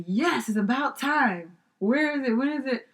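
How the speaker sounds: lightly hammed-up excitement; excited friend. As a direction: act out a friend thrilled for you. Friend reacts to fashion show news.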